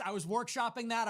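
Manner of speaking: ordinary talk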